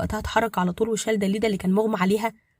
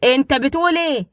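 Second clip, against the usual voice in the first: slow, furious disbelief, higher and louder; 5 semitones higher, 7 dB louder than usual. A narrator's reading. Badr to Hamed on the phone, furious disbelief. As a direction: spit out انت بتقول ايه in rage. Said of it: "فقال بغضب شديد".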